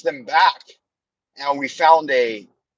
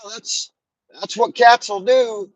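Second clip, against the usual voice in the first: put-on higher, matter-of-fact old grandma voice; 7 semitones higher than usual. Grandma calmly telling the crying boy. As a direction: shrug it off like a country grandma. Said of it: "Grandma's like".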